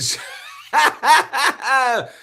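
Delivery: loud, high burst of laughter; big laugh. Real, not acted. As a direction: let out a loud cackling laugh.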